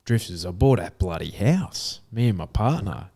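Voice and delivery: ordinary delivery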